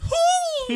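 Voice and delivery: very high, loud sustained hoot; imitated high whoop. Co-host imitating Nathan's outburst, high mock whoop. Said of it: "every time Nathan would have gone".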